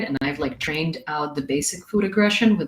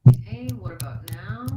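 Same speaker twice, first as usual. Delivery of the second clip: far from phone mic. Real, not acted. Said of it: "Still, still sounds like you're far from the mic".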